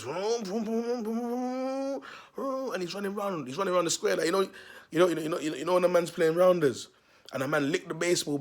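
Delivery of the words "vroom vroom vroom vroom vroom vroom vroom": revving vroom climbing in pitch; imitated moped engines. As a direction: mimic mopeds revving, sliding higher and higher. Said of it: "two guys on mopeds".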